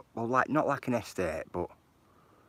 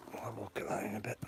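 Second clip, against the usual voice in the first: whispered aside; 9 dB quieter, faster than usual. Real, not acted. Aside under breath.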